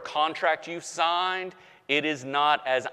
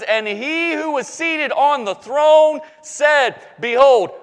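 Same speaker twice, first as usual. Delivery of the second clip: proclaiming shout; 9 semitones higher, 8 dB louder than usual. Acted. Pastor reading God's words to the church, proclaiming loudly. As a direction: call it out like from the throne. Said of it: "proclaiming the throne's words".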